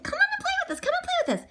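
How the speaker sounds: high-pitched animal friends calling; squeaky playmates' invitation; pleading little friends. Acted. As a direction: call out in a small high voice. Left-out friends call to Fred.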